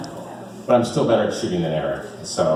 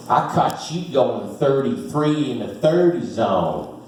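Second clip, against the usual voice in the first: put-on Southern accent. Traffic cop threatening a pulled-over driver, Southern accent. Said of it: "a real Southern accent like this".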